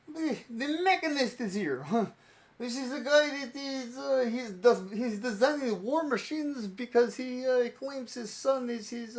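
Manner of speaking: put-on high-pitched Bratislavan accent. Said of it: "doing the Bratislavan one".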